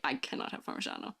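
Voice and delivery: breathy hushed confession; confessional whisper. Acted. Whispering her Parmesan confession to the chat. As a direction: whisper it like a guilty secret.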